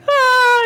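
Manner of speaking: loud, high wail; mock bawling wail. Sisters crying in answer to their father, loud high mock wail. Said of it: "sisters just crying".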